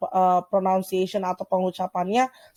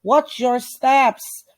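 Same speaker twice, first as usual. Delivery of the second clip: slow model reading; 3 semitones higher, much slower than usual. Shown as a demonstration. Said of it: "teacher models warning sign".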